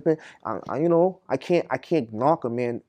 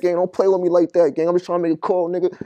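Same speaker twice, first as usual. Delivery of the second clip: much higher and far louder furious yell; 9 semitones higher, 12 dB louder than usual. Retold or imitated. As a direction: shout them down over the phone. Retold peak of phone fight.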